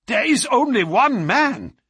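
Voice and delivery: high, loud cry of realisation; astonished cry. A narrator's reading. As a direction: burst out in amazement. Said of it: "he cried".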